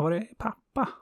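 put-on sun's child asking again, high and small. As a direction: repeat the question like a small kid. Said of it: "hennes barn frågade".

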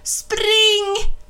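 high, loud panicked scream. A narrator's reading. Charlotte screaming at Ben to run, loud and high. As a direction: yell it out in terror. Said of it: "skrek Charlotte".

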